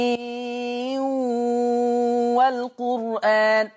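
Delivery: "ياسين والقرآن" بإدغام النون في الواو; تُنطق "ياسين والقرآن" بلا نون قبل الواو، بترتيل بطيء; ياسين والقرآن بالإدغام. Shown as a demonstration. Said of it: "عايزين نسمعها". (تلاوة مرتلة لـ"ياسين والقرآن" مدغمة)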